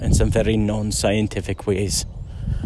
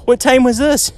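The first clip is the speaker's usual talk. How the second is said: surprised question; 13 semitones higher, 3 dB louder than usual. Retold or imitated. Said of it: "And he goes".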